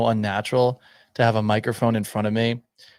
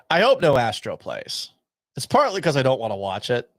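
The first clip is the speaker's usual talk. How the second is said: normal talking voice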